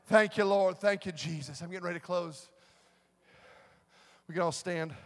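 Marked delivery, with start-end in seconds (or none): none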